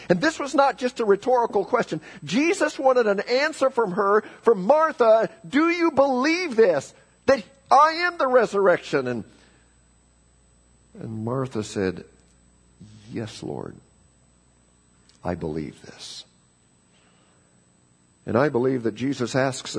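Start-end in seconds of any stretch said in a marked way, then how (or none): 0.1-9.1 high, forceful pulpit voice; impassioned preaching. Acted. Preacher presses the question at full force. 13.1-16.2 low, soft, solemn reply; quiet confession of faith; hushed reverent answer. Retold or imitated. Voicing Martha's answer of faith.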